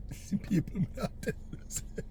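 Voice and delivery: chuckling to himself as he speaks; real quiet laughter in the words; laughing softly. Real, not acted. Laughs after retelling the story.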